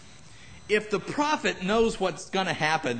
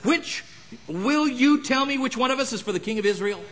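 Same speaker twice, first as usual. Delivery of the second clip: enraged; 9 semitones higher, 4 dB louder than usual. Acted. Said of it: "was enraged over this thing".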